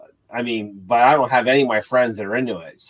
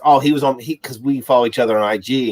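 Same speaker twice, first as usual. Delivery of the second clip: ordinary talk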